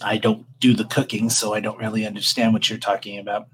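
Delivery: low, soft, dry mortician; a cold undertaker, unbothered; tiefling mortician voice. Acted.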